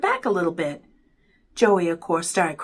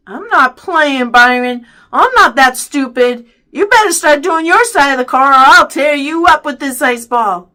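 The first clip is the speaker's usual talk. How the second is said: loud, higher warning; annoyed threat; 5 semitones higher, 10 dB louder than usual. A narrator's reading. Boy threatens tricky brother with an ice ball, loud threatening. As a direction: warn him off with force.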